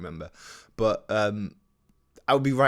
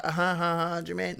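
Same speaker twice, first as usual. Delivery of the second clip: high sing-song mock laugh; teasing; 8 semitones higher than usual. Child mocking an older brother, high and teasing. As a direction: taunt like a gleeful little sibling. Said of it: "I love teasing him".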